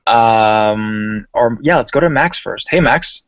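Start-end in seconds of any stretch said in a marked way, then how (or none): none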